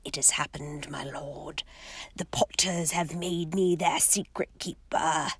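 hushed, low mutter with an eager edge; low excited mutter. Acted. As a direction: mutter it low, barely containing glee. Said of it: "a low, excited mutter".

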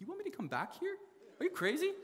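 high-pitched mock disbelief; incredulous. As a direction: squeak it out, can't believe it. Disbelief at being told return.

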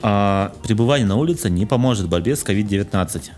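ordinary talk

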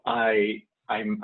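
ordinary talk

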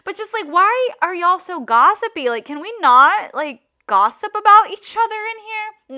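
high, loud exasperated questioning; exasperated complaint. Real, not acted. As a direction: plead in disbelief, voice jumping up. Complaining about gossipy staff.

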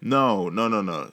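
normal talking voice

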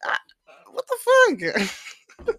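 high, breathy laughing what-the; half-laugh it out in disbelief; mock shock caught on a giggle. Retold confused reaction to block.

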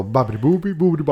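lightly mocked Italian-accent babble. Podcaster mocking actors' accents in English-language films, mock Italian accent. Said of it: "την προφορά και μιλάνε αγγλικά".

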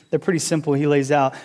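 ordinary talk, nothing marked

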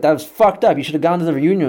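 high, loud mock complaint; aggrieved dad. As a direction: imitate an indignant father. Acted phone quarrel with dad.

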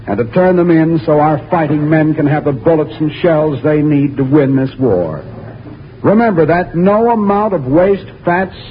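ordinary delivery

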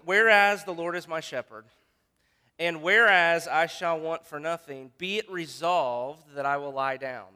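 pompous whereas-and-resolved legal reading; mock legal resolution. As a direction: proclaim it like a council passing a bill. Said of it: "That's what he's saying".

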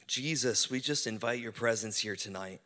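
slow, hushed praying voice. Acted. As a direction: pray it out gently, slowly. Leads a prayer over music.

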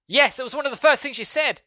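very high, loud mock exasperation; exasperated retort. Brother answering David's clueless apology, acted exasperation.

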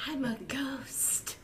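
breathy, slow spooky whisper; ghostly whisper. Acted. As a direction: hush it like a haunting ghost.

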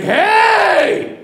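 high, sharp mock yell; guard's angry shout. Russian guard confronts the couple, high mock shout. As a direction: imitate an outraged guard yelling. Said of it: "Then the Russian guy's like".

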